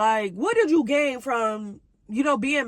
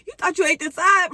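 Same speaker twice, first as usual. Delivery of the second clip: mocking taunt; 7 semitones higher, 7 dB louder than usual. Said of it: "so I start laughing at her".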